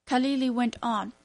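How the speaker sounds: usual speaking voice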